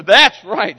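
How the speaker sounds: loud mock wife agreeing; imitating a wife. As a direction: play a wife calling out her agreement, a bit hammed-up. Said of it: "she says".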